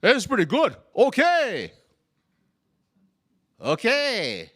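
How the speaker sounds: high, loud, exaggerated approval. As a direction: pronounce it pretty good with big delight. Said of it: "Lo Fan like".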